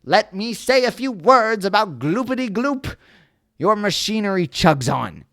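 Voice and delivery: high, loud angry snap; snapping angrily. Acted. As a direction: snap at him, dander up. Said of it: "snapped the Lorax, his dander was up".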